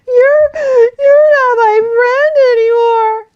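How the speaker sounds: high, loud, slow crying fox voice; little fox hurt by the bear, crying; sad and tearful. Acted. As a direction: wail it through tears. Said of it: "said Figgy Two Socks sadly".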